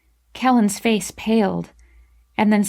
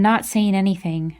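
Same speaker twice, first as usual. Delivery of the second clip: plain reading voice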